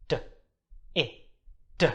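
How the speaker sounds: D, I, D repeated sound by sound; sounded out again. Shown as a demonstration. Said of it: "One more time".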